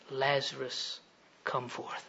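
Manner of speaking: very soft, low, deliberate call; hushed command. Acted. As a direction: summon one man quietly, with authority. Jesus calls only Lazarus, hushed.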